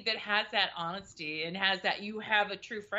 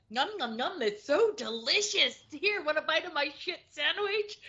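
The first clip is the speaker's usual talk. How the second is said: higher, hammed-up savoring; mock delight; 7 semitones higher than usual. Comic mocking the grind, hammed-up delight. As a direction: pretend it tastes wonderful. Mock eating shit sandwich.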